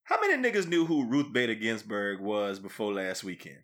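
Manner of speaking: usual speaking voice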